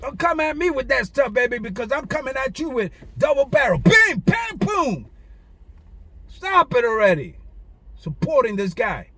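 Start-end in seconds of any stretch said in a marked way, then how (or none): 3.8-5.1 mock gunfire. Real, not acted. Imitating gunfire sounds.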